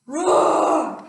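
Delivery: roaring in the bus driver's face; dinosaur roar. Acted. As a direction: roar right in his face. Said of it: "he roared in the driver's face".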